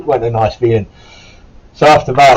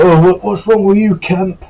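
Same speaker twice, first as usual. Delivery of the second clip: high, mocking imitation of the corporal; 9 semitones higher, 4 dB louder than usual. The corporal asking the injured recruit, in a high mocking voice. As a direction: put on the corporal's higher voice to retell his jab. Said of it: "And he was like".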